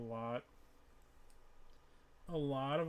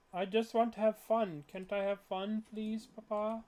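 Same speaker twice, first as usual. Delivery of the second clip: put-on higher, whiny spoiled-kid plea; childish whine; 5 semitones higher than usual. Player whining to Papa about wanting fun, mock childish whine. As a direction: whine like a child begging his dad.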